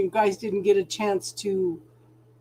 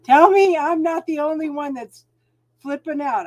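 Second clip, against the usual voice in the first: higher and louder, pleading; exasperated; 6 semitones higher, 7 dB louder than usual. Real, not acted. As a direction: beg the chat to agree with you. Venting about the Scorpio energy.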